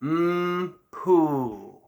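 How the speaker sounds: "mm, poo" with pouty, pursed lips; mm poo embouchure. Shown as a demonstration. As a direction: shape "mm, poo" slowly, lips pushed forward. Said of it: "I'll show you again".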